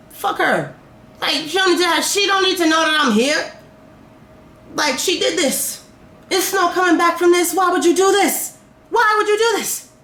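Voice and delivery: high-pitched, hurt outrage, building; upset, high-voiced reproach; indignant protest. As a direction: argue it high, rising to an exasperated plea. Acted protest at the accuser.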